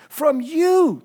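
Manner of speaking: "From you" thrown out high and loud; emphatic. Shown as a demonstration. Preacher voices Paul addressing the Thessalonian church, emphatic.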